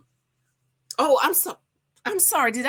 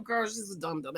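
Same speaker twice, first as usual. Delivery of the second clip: ordinary delivery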